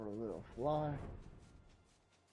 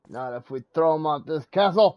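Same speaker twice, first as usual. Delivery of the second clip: loud, higher heckle at the screen; heckling shout; 6 semitones higher, 21 dB louder than usual. Real, not acted. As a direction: yell a cheeky threat at the character. Heckling cutscene character.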